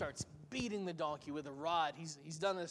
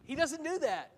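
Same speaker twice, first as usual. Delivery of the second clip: mock surprise; 8 semitones higher, 4 dB louder than usual. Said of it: "I think I would have said".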